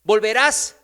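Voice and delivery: loud, slow prophetic declamation; full-force preaching. Acted. Prophet Elías delivers God's sentence to the king, declaimed loudly. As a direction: thunder the verdict out slowly.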